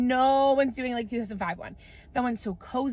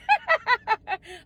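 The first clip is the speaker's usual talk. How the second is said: high burst of helpless laughter; real laugh; 11 semitones higher, 4 dB louder than usual. Real, not acted. Vlogger cracks up at her own failed accent, laughing. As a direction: crack up at yourself.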